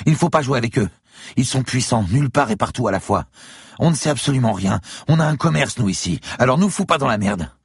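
high, loud, agitated female voice; urgent pleading. A narrator's reading. Woman warning the gunman about powerful men, urgent. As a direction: plead with him to leave you out.

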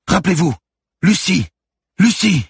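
very high, loud repeated shouts; desperate full-voiced screaming; screamed plea. A narrator's reading. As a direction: yell the name to make her remember. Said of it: "hurla le lieutenant".

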